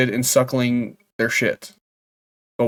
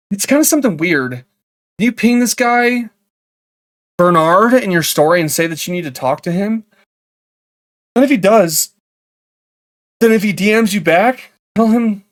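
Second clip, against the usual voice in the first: higher-pitched put-on asker voice; halting, coaxing request in a raised voice; help-seeker role; 6 semitones higher, 3 dB louder than usual. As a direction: play the stranger asking an awkward favor. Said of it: "asking for help".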